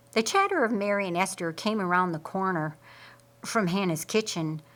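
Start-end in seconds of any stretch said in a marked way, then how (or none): none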